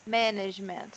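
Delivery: MANAGEMENT with a strong MAN, the rest light; "management" stressed at the very start; first-syllable stress. Shown as a demonstration. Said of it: "a primeira sílaba é a forte".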